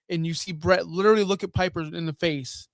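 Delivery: normal talking voice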